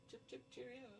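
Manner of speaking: high, soft posh British "Chip, chip, cheerio"; mock British accent. Mocking how Canadians supposedly talk, fake British accent. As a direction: toss off a silly British cheerio. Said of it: "They talk like you up there".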